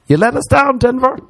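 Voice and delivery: very high-pitched comic wail; squeaky-high mock reproach to a whole city; mock outrage. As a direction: send the rebuke way up high. Mock rebuke repeated, much higher.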